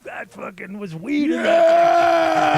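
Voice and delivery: very high, loud, drawn-out shout; excited shout. Acted. Telling friends the stranger's jackpot, drawn-out excited shout. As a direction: yell the punchline slowly.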